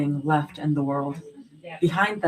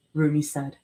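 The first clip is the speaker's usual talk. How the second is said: usual speaking voice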